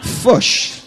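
loud mock accent, fish as "Fush"; mimicked accent. Preacher teasing the guest's way of saying fish, mimicked accent. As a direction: imitate the accent, short dull vowel in "Fush".

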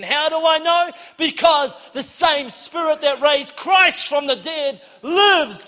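loud, high, driving sermon climax; full-force preaching. Real, not acted. Urging the church to demand God's power, fervent preaching. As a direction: preach it with rising fervour, punching key words.

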